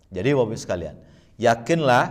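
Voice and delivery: loud, high, rousing sermon voice; preacher urging parents, loud and fervent; preaching at full force. Acted. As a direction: drive the message home to the congregation. Preaches to the audience.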